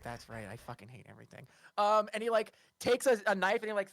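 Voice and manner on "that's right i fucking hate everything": low grumble. Acted. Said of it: "he's like".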